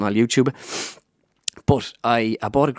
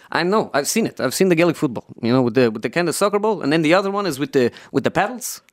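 impersonated manager, higher, rising at the end; Jose Mourinho; 5 semitones higher than usual. Acted. Manager describing hurling to the impressionist, put-on voice. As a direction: put on his voice with raised pitch. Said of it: "He goes".

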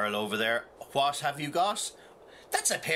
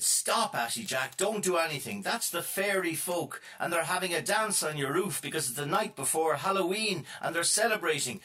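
high pleading brother voice; 7 semitones higher than usual. Acted.

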